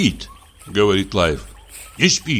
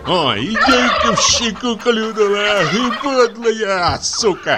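pained outcry; 7 semitones higher than usual. Acted. Pecked by turkey.

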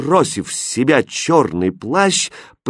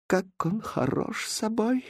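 high, soft, slow swoon; hushed, enchanted admiration; admiring; 10 semitones higher, 11 dB quieter, slower than usual. A narrator's reading. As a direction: breathe it out in rapture. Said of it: "восхитилась вторая дама".

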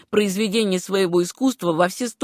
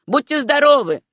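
higher, louder parting call; called farewell; 7 semitones higher, 5 dB louder than usual. A narrator's reading. Baker calls after Susanna as she leaves, raised hearty farewell. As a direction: call goodbye after someone leaving.

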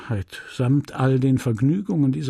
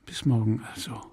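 soft, intimate goodbye with a caress; quiet loving parting; tender farewell; 2 semitones lower, 4 dB quieter, faster than usual. Acted. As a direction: say it gently, close to him. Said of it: "mit einer letzten Liebkosung".

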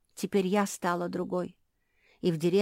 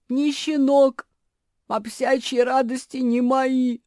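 high, loud distressed voice; anguished lament; 7 semitones higher, 9 dB louder than usual. A narrator's reading. Куки mourns that puppy joys are gone, lamenting loudly. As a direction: wail it out with mild anguish. Куки grieves being a dragon.

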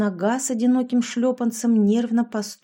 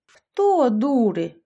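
slow, louder indignant outburst; flaring up; 3 semitones higher, 4 dB louder, much slower than usual. A narrator's reading. Yashka insulted by Sasha, flaring up slow and loud. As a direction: bristle at the insult, dragging it out. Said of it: "взвилась Яшка".

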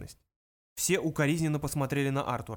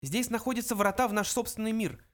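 high little mouse voice; high-pitched small creature; 8 semitones higher than usual. A narrator's reading. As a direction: state it as a tiny mouse. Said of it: "вставил Бенджи".

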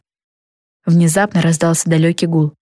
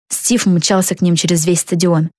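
usual speaking voice

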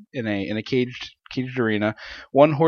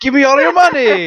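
higher, louder, laughing stick-up demand; mock robber; 11 semitones higher, 5 dB louder than usual. Co-host playing a robber in the courtyard, laughing mock threat. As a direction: ham up a cartoon robber.

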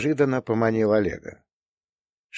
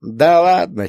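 put-on giggling old prospector; 7 semitones higher, 5 dB louder, slower than usual. A narrator's reading. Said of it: "хихикнул старик".

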